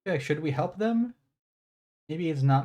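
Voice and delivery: ordinary talk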